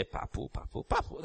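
put-on soft breathless child's babble; a toddler babbling in church, barely audible. Grandpa mimicking the little one, breathy and hushed. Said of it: "you are supposed to be quiet and not say".

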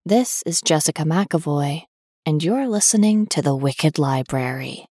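ordinary talk

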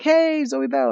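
high-pitched hello of recognition; retold excited greeting. Voices delighted recognition of actress.

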